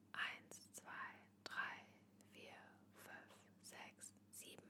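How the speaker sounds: whispered count, barely audible; whisper countdown. Shown as a demonstration. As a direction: breathe the numbers out silently. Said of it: "ich mach das einmal vor".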